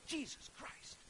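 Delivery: breathy, unvoiced exclamation under the breath; movie curse. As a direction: toss off the name like a muttered movie oath. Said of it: "you'll still hear".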